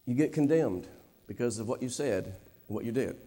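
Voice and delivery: softly broken preacher confessing a mother's self-hatred; hushed confession. Acted. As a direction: let it sink to a near-whisper of shame.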